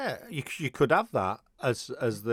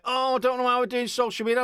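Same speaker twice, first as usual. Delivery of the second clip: higher mocking whine; mocking whiny voice; 9 semitones higher than usual. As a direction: mimic a clueless band, higher. Mocking band post.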